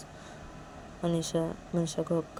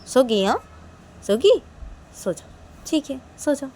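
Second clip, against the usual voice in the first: loud, raised, indignant scolding; angry threats; 3 semitones higher, 9 dB louder than usual. Acted. Girl berating her sulking friend in bed, loud angry scolding.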